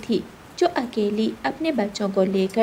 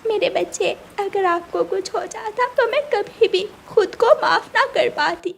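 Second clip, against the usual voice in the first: higher, tender mother voice; mother bird; 10 semitones higher than usual. Acted. Mother bird hugging her hurt chick, tender voice.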